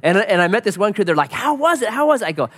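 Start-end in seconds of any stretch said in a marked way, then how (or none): 1.3-2.3 eager voiced hikers.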